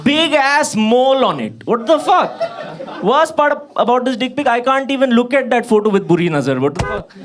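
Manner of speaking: high-pitched, hammed-up comic disgust; appalled rant. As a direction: rant in mock horror at too much information. Appalled rant about the picture.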